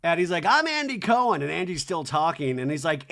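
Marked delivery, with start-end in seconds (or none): 0.5-1.4 louder, higher show-opener imitation; TV host intro. Host talking over a chatting guest, raised announcer imitation. Acting host's on-air intro.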